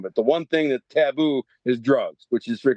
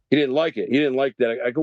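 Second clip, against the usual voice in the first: ordinary talk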